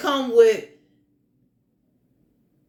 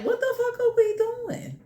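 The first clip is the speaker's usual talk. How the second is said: much higher, softer exasperated question; incredulous universe; 10 semitones higher, 3 dB quieter than usual. Retold or imitated. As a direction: squeak it out in disbelief. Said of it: "it's like".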